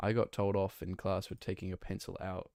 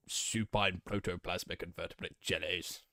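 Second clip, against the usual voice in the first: blustering British politician impression; Boris Johnson; 2 semitones lower than usual. Streamer quoting to unlock the voice, British politician impression. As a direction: orate it in a put-on British statesman's voice. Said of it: "talk like Boris Johnson".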